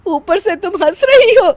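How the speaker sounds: indignant mother-in-law telling off the giggler; annoyed scolding. Acted. Mother-in-law scolds laughing daughter-in-law. (झुंझलाकर ज़ोर से डाँटना)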